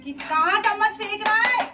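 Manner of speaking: high, loud telling-off; sharp scold. Real, not acted. Mother scolding child for throwing a spoon, loud and high.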